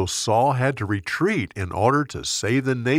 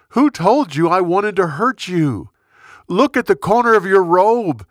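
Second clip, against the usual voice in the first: higher, louder impassioned plea; earnest pleading; 7 semitones higher, 4 dB louder than usual. Acted. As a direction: plead your case to the king with rising fervour. David pleads his innocence to Saul.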